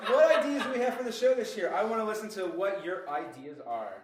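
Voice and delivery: ordinary delivery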